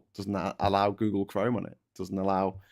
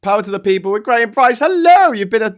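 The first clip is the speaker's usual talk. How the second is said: loud, high announcer shout-out; 11 semitones higher, 9 dB louder than usual. Acted. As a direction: boom it like a show host. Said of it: "announcing member shout-out".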